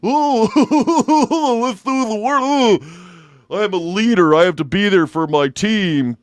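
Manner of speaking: high, giddy eager-worker voice; mocking past self. Comedian mocking himself going to work, naive eager voice. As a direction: trill it high, then ease into earnest gung-ho. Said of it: "I was going to work every day like".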